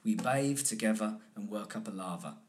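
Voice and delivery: relaxed London accent with v for th; teacher modelling a London accent. Shown as a demonstration. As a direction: say "bathe" as "bave", London style. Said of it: "changing the physical structure of how I'm doing the accent".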